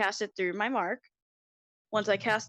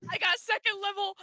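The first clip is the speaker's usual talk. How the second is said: high excited squeal; thrilled, high-pitched announcement; 11 semitones higher than usual. Real, not acted. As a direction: blurt out good news, pitched way up. Player thrilled by new spell slots.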